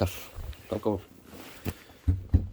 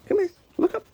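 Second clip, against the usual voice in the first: high, louder coaxing coo; 5 dB louder, faster than usual. Real, not acted. Farmer coaxing a kitten to look up in baby talk.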